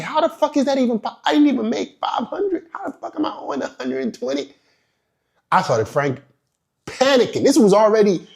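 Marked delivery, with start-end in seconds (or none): 0.0-4.5 panicked disbelief. Retold or imitated. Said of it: "I was like".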